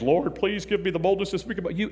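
soft, put-on pious prayer voice; mock the churchy prayer people perform on stage; lightly hammed holy tone; showy stage prayer. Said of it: "you've heard people pray prayers on stage".

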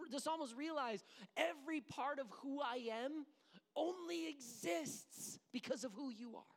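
normal talking voice

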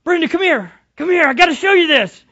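high, loud excited call to a spouse; thrilled, calling her over to see. Acted. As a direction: voice climbing into an excited shout. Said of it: "and you say".

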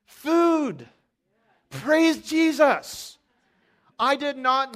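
high, loud and drawn out; loud rapturous praise; excited. Real, not acted. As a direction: savor the words like a joyful shout. Pastor exclaims over shared food.

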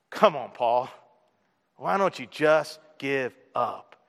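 low, slow mocking taunt; a low, slow mocking voice urging Paul to give up. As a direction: goad him to quit. Mock tempter taunting Paul.

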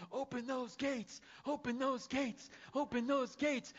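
high, loud, slow chant at the gates; eager shouting. Pretend. Pastor outside heaven's gates, calling loudly to be let in. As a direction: shout it like a fan demanding entry. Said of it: "standing outside the pearly gates going".

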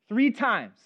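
ordinary talk, nothing marked

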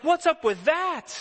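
high, slow, disbelieving "what's up with that"; incredulous David. Retold or imitated. Preacher voicing David's disbelief at the taunting, high and slow. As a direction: play David's outrage, lightly mocking. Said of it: "And he's like".

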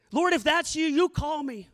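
ordinary talk

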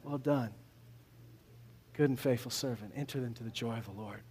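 softly spoken, tender praise; tender God. Retold or imitated. Preacher voicing God's gentle welcome, soft and tender. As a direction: say it gently, like a proud father. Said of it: "like to hear him say".

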